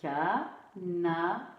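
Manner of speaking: "Ca-na-ri" slowly in three separate beats, rising; syllable by syllable. Shown as a demonstration. Teacher counting three syllables for pupils, syllable by syllable. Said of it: "Trois syllabes".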